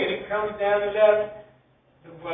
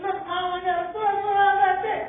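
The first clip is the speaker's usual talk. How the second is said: put-on excited kid; 9 semitones higher than usual. Excited kid shows off torso.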